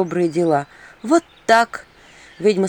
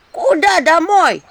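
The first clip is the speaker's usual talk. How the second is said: high, louder impish voice; 9 semitones higher, 5 dB louder, slower than usual. Acted. Imps scold the witch, high loud impish voice. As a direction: chatter like an indignant little imp.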